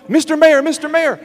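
calling out high across a parking lot. Retold or imitated. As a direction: hail him high and loud before he walks off. Calling after the mayor in parking lot.

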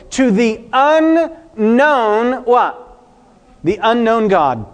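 "unknown" drawn out slowly and lifted; stretched unknown. Acted. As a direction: linger long on "unknown", leaving it hanging. Preacher dramatizes inscription reading.

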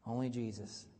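ordinary talk

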